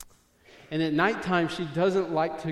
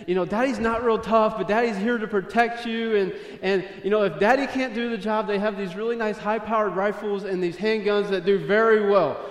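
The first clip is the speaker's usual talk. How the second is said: louder, higher, boastful dad pep talk; emphatic fatherly reassurance; 6 semitones higher, 8 dB louder than usual. Retold or imitated. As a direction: talk up Daddy's protection with punch. Father hyping up his protection to child.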